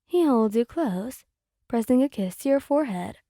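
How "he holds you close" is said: ordinary delivery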